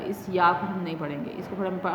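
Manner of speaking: usual speaking voice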